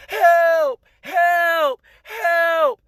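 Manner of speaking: high, loud cries for help; imitated screams. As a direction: cry out like someone in torment. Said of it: "I heard screams like".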